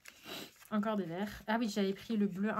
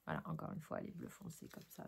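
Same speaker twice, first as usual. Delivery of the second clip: quiet aside; 14 dB quieter than usual. Real, not acted. Muttered aside while unpacking.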